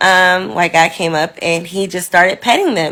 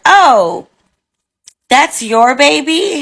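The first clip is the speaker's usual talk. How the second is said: higher, slow, taken-aback question; retold astonishment, pitched up and drawn out; imitated surprise; 8 semitones higher, 4 dB louder, slower than usual. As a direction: act out a nurse caught off guard. Retelling the surprised nurse's reaction.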